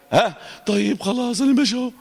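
mock-meek letter going along; meek weak letter. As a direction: play the feeble neighbour meekly caving. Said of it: "اللي جنبه هزيل".

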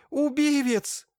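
very high, loud wail; yelling accusation. A narrator's reading. Marfusha accuses the doctor of murder, high-pitched yell. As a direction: scream it out, drawing on a high note. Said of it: "заорала Марфуша".

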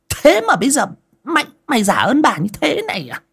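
sour, high, loud shrew of a wife; shrill shrew. Acted. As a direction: spit venom at the servant girl. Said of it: "giọng chua loen loét". (giọng chua loen loét của mụ Tập)

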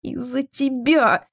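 high, slow accusing cry; tearful cry. A narrator's reading. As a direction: cry out, fighting back sobs. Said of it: "выкрикнула Кассандра, борясь с рыданиями".

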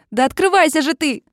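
high loud strain; frustrated shout. A narrator's reading. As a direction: yell at the stuck door. Оливия рвёт заклинившую дверь. (отчаянный крик)

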